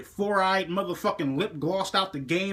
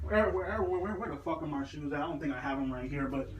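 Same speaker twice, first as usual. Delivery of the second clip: quiet aside; 5 semitones lower, 9 dB quieter, faster than usual. Real, not acted. Searching off-camera for his shoes.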